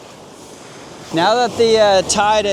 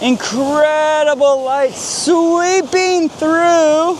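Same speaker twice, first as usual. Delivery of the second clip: slow, higher, awed; awed excitement; 8 semitones higher, much slower than usual. Real, not acted. Photographer marvelling at the light, slow and awed. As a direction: savour each word of the view.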